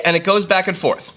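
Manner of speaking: ordinary talk, nothing marked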